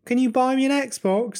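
lightly mimicked son's voice; pleading son. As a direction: pitch it up like a child's request. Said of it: "if his son was like".

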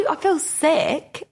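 high, drawn-out mock complaint; feigned sickness. Acts out pretend sick excuse.

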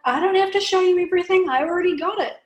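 tossing off the line like a cool fashion icon; confident icon. Retold or imitated. Host imitating the Coco Chanel look, lightly voiced, breezy and sure. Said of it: "you know, she's like".

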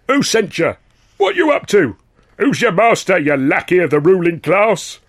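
high, loud jabbing interrogation; hostile questions. Acted. Angry dockers grilling a suspected spy. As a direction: hurl the questions like punches.

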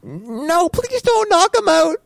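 put-on high pleading child voice; kid voice. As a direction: beg like a small kid. Kid voice to dad.

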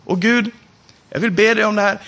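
ordinary delivery

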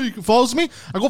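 normal talking voice

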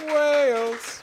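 high excited shout of the country name; excited cheer. Real, not acted. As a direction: cheer it out like a game show host. Cheering contestant's home country.

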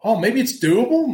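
high, surprised and hopeful; a sudden "oh, maybe I can"; rising with wonder at the thought; dawning realization. Retold or imitated. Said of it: "there's a realization going".

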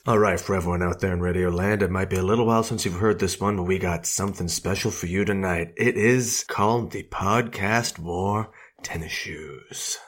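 smooth, easy radio host; laid-back DJ slowing into the title; late-night jazz jockey. Pretend. Jazz jockey introducing obscure B-side, laid-back patter. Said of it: "as if you're a late night jazz jockey".

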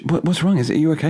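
high-pitched mock concern from a wife; imitating worried wife. Wife's worried questions voiced in story.

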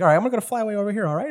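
lightly voiced hurried Jarvis. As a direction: rattle it off in a light character voice. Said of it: "he's like".

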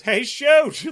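loud, high friendly call across the stands; character call. Retold or imitated. Co-host retelling the antagonist's greeting, mock call. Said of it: "and he goes".

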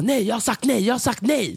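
retold, high-pitched firm refusal; imitating insistent refusal. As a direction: imitate a bouncer refusing over and over. Retold argument at club door.

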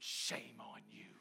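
retold whispered rebuke; mock-shocked whisper from a stranger. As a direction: breathe it out as a scolding whisper.

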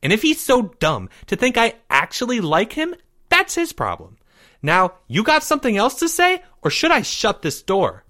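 high, indignant retort; scornful. A narrator's reading. Girl about to shut the door, high and scornful. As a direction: challenge him and be done. Maya angry, dismissive.

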